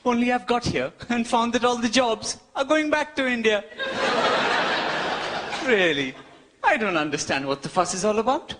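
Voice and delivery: ordinary talk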